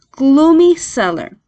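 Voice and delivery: ordinary delivery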